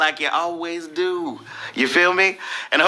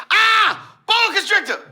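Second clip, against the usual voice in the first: high panicked scream. Retold or imitated. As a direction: shriek the snake's name in fright. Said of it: "every time you scream".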